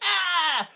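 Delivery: high-pitched imitated yelp; cartoonish cry at being hit; mock pain cry. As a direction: let out a hammed-up "ah" of pain. Imitating Bert shot in leg.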